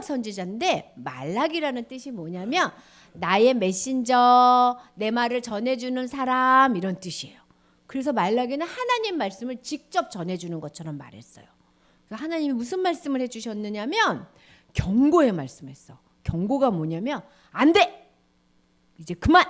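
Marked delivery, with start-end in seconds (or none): 3.1-4.8 slow, loud, each syllable stretched; slow emphasis. Shown as a demonstration. Teacher explaining Malachi's name, slow emphatic. 17.5-19.4 loud, high stop-it cry; warning shout. Shown as a demonstration. Teacher demonstrating a warning to a child, loud shout. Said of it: "이게 경고예요".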